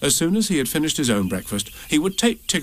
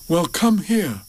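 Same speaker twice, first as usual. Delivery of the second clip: slightly raised storybook bear voice; Pooh; 5 semitones higher, slower than usual. A narrator's reading. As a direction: invite the stranger in. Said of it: "said Pooh".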